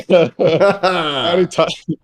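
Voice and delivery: can't hold back the giggle; laughing. Real, not acted. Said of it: "I can't keep a straight face with this one".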